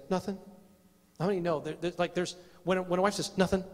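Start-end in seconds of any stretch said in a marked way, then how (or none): 0.1-0.4 imitating a wife's clipped-short answer; quick dismissive. Wife brushing off husband's question, quick dismissive. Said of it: "real fast like that". 3.3-3.6 imitated wife's very quick brush-off; real fast. Wife answering "what's wrong", real fast. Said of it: "real fast like that".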